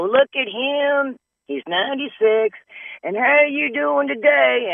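high, slow, sugary fuss; mock adoring high voice; gushing onlookers. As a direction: coo over the old man like a baby. People gushing over old man.

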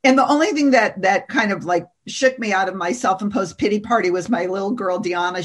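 normal talking voice

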